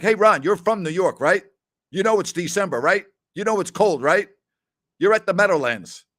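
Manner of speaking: retold scolding, building point by point; retold chiding. Retold chiding of underdressed friend.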